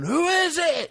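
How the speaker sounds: high, strained mock holler; yelling over noise. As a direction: holler it over a roaring chainsaw. Retold Zach yelling over chainsaw.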